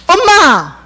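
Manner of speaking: calling out. Retold or imitated. Son calls out to mom.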